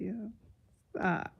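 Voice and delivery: usual speaking voice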